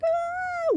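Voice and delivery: a high, imitated wail of grief; a short, high mock wail. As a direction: yelp it out like someone wailing in grief.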